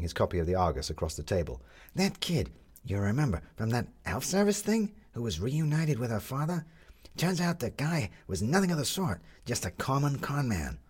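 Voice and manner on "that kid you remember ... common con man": put-on a light young gentleman voice, a touch higher; lightly voiced Roger. A narrator's reading. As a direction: gossip over breakfast, lightly voiced.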